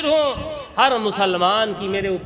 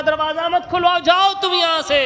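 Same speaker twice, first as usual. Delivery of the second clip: higher, raised voice, shooing him off; angry dismissal; 9 semitones higher than usual. Acted. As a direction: shout her to go away. Widow sends the caller away.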